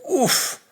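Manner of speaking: a sudden high-pitched "oof" of shock; short stunned grunt, pitched high; startled oof. Retold or imitated. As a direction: blurt it out like you've been hit by something. Reacting to the girl appearing.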